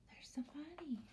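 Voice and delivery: hushed whisper. Pretend. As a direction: whisper as if not to scare him.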